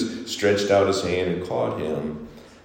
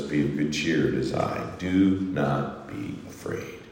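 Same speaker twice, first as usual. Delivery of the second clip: soft and low, reassuring calm; Jesus calming his disciples, softly; gentle reassurance; 5 semitones lower, 8 dB quieter than usual. Acted. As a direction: comfort a frightened friend softly. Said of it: "Jesus shows up and says".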